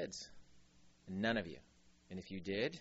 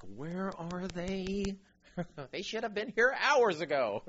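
drawn-out, higher-pitched complaint; mock impatient Jesus; 6 semitones higher than usual. Jesus waiting for late people, mock exasperation. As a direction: play Jesus as comically put out. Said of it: "Jesus is like".

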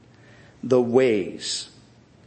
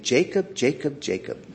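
soft, lightly voiced nagging conscience; lightly mimicked little voice, dropping softer; little inner voice; 4 dB quieter than usual. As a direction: imitate a small voice repeating a gentle warning. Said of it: "there was this little voice saying".

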